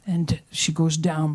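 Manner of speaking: ordinary delivery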